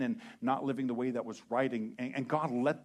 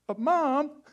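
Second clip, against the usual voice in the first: put-on much higher, louder kid whine; whining kid; 12 dB louder than usual. Child complaining to mom, whining. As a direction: protest like a teen.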